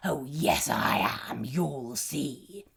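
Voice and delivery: low, hushed troll threat; low gloating menace; lightly voiced low troll; smug troll. A narrator's reading. Troll insists.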